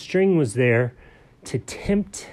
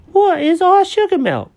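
much higher, louder puzzled little cat; curious kitty voice; 7 dB louder than usual. Acted. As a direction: ask it like a wide-eyed kitten.

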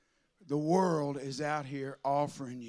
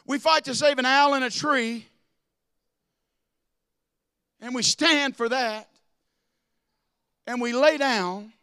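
raised, loud preaching voice; preaching at full force; 7 semitones higher, 9 dB louder than usual. Acted. Pastor rails to his church, loud preaching. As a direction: hammer it home to the congregation.

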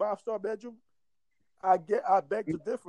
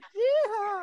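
mock cowboy yell; 13 semitones higher than usual. Mocking rednecks, cowboy holler.

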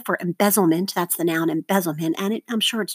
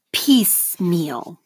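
"Piecemeal" slowly, PIECE stressed; stress on first syllable; 2 semitones lower, much slower than usual. Shown as a demonstration. Teacher models for seventh graders to mark, slow stressed PIECE in "Piecemeal". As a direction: say "Piecemeal" as PIECE-meal, dropping on meal. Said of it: "circle the stress syllable".

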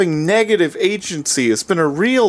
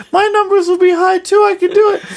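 high, pushy mock girl voice; Asuka; 5 dB louder than usual. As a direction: boast high and eager to compete. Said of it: "And she's like".

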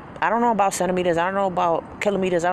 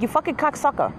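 angry retort; 9 semitones higher than usual. Retold or imitated. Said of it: "I'm like".

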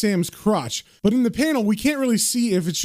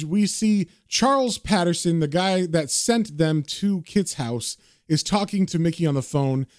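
ordinary delivery

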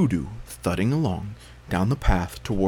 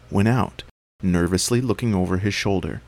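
ordinary delivery

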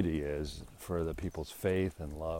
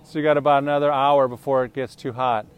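high, loud friendly call across a yard; 9 semitones higher, 14 dB louder than usual. Real, not acted. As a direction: greet the neighbours from a distance. Calling out to neighbours outside.

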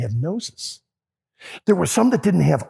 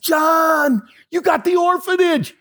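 excited recognition; 12 semitones higher, slower than usual. Retold or imitated. Jim recognizing friend, excited.

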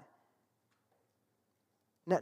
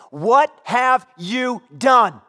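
"What have you done" loud, high and very slow, each word pounded; very emphatic accusation; 6 semitones higher, 10 dB louder, much slower than usual. Acted. Finding a loved thing broken, "What have you done" slow, loud, accusing. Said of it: "it's a very emphatic, uh, not very nice way".

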